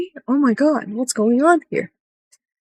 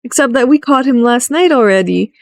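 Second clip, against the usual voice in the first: sarcastic correction; 7 semitones higher than usual. Retold or imitated. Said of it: "It's like".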